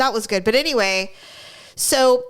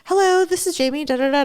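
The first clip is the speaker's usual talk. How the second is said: retold receptionist; 5 semitones higher than usual. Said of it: "proficient, smart, intelligent person".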